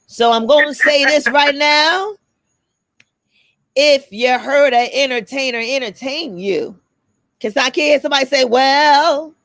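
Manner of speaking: preacher voice calling out; church voice. Pretend. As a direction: preach it to the congregation. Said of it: "So I'm gonna do my church voice".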